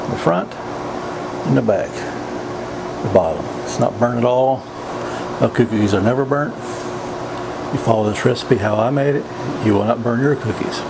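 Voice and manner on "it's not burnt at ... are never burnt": usual speaking voice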